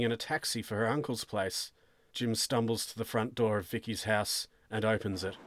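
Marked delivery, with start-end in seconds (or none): none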